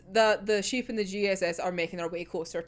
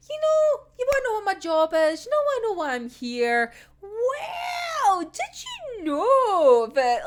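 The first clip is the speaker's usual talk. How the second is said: teasing Dazai; 9 semitones higher than usual. Retold or imitated.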